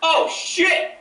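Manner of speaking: high, laughing "oh shit"; shocked exclamation. Retold or imitated. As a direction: blurt it out in stunned delight. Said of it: "I was just like".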